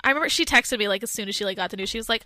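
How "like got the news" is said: usual speaking voice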